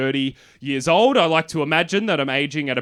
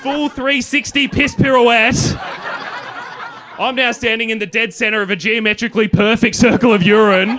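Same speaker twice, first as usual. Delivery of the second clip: much higher and louder, laughing; excited; 11 semitones higher, 5 dB louder than usual. Real, not acted. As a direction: build to a gleeful peak. Punchline told with big energy.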